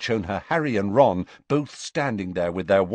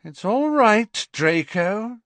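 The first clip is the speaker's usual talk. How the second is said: soothing Narcissa; 13 semitones higher, 5 dB louder, slower than usual. A narrator's reading. Narcissa restrains son.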